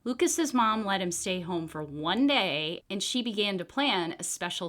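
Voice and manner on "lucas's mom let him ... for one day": ordinary delivery, nothing marked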